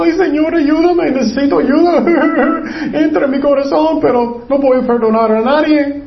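high-pitched, hammed-up wailing prayer; mock crying. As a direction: plead through exaggerated fake sobs. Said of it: "ellos están llorando mucho".

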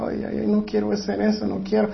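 lamento quejumbroso, mucho más suave; queja suave imitada. Retold or imitated. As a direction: imita a un padre que no quiere castigar, quejándose bajito. Padre reticente imitado, muy bajo. (quejido suave de padre indeciso)

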